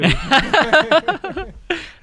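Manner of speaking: host's loud, high laugh; big laugh. Real, not acted. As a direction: laugh out loud at a good punchline. Host laughs at story.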